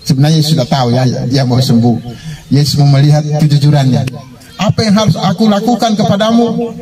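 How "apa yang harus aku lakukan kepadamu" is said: high-pitched testing question; emphatic question. Retold or imitated. Jesus testing the beggar's honesty, emphatic high question. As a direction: ask it in a markedly raised pitch.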